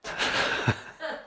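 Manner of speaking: high wheezy laugh at the punchline; high breathy burst of laughter; laughing at own joke. Real, not acted. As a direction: crack up at your own punchline.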